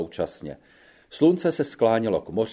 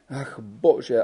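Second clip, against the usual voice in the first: lamenting. A narrator's reading. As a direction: sigh out Ach bože in despair. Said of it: "naříkala". (naříkavě, tiše)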